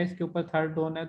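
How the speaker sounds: ordinary talk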